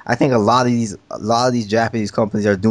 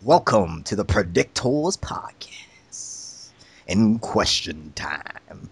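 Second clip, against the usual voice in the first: slow, eerie whispered welcome; creepy slow whisper; 4 semitones lower, 4 dB quieter, much slower than usual. Pretend. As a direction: whisper it like a spooky host. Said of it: "You don't wanna scare him away".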